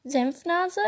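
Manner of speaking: Senfnase asked back high, rising and baffled; puzzled question. Acted. Little fire Pokémon hearing its new nickname, puzzled rising question.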